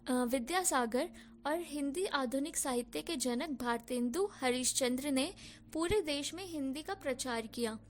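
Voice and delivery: ordinary delivery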